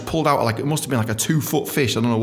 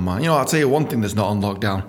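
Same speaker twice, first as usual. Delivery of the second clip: ordinary talk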